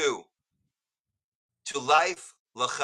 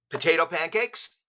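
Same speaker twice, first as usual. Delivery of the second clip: ordinary talk, nothing marked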